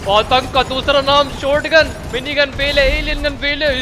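high-pitched, drawn-out proclamation; excited gamer hype; hyped-up boast. Pretend. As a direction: proclaim the gun's greatness like a slogan. Hyping the new shotgun.

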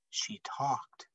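hushed whisper; whispering the little miracle. A narrator's reading. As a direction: let it drop to a whisper, like a secret. Soft payoff in story reading.

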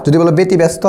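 ordinary talk

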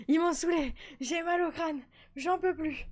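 mock whining. Exhausted by the babies, mock whining. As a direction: whine like you're done. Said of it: "je pleure".

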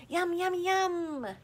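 put-on high, slow toddler yum yum yum; gleeful toddler. A narrator's reading. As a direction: drag it out like a happy little boy. Toddler relishing the flowers.

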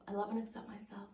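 whispered fast, almost to herself. Retold or imitated. Client whispering affirmations at the mirror, a whisper.

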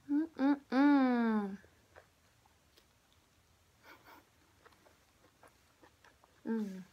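humming while chewing food; muffled through a mouthful; mouth full, chewing. Real, not acted. Seen 0: Bites the roll at -1, then chews with a full mouth throughout.

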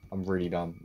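ordinary talk, nothing marked

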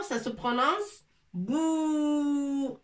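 "bou" held long and slow; stretched bou. Shown as a demonstration. Teacher stretching "bou" for the class. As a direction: draw out the "ou" of "bou" as a long vowel. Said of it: "Ba avec waw".

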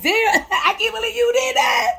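high laughing amazement at herself. Retold or imitated. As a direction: laugh and cry out at your own reflection. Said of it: "look at yourself in the mirror like".

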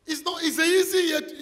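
high, loud burst; excited shout. Real, not acted. Preacher shouting in fervour at the congregation.

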